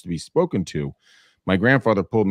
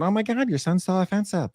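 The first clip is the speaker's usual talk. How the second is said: much higher mock-prissy gasp; mock the shocked gossiping friends; scandalized parent's friend; 11 semitones higher than usual. Parents' friends calling, in a high mock-scandalized voice. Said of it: "calls from their friends going".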